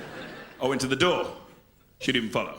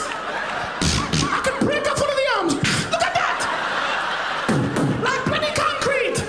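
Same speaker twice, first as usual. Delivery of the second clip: mum's shrill rant. Retold or imitated. Jamaican mum finding stiff pajamas, outraged scolding. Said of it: "My mum would ... and go".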